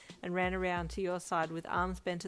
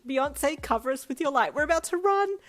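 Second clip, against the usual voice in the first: much higher, much louder rallying cry; loud dramatic plea; 8 semitones higher, 10 dB louder than usual. Acted. Brian preparing the escape, loud dramatic cry. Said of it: "he said loudly".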